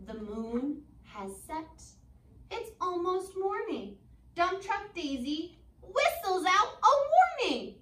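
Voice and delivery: slow, lilting storybook read; gentle read-aloud for kids; reading to children. Acted. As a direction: read it like a bedtime picture book. Teacher reads picture book aloud.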